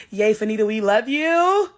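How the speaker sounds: sing-song imitation of a fan comment; mock fan cheer. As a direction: coo it like an adoring fan.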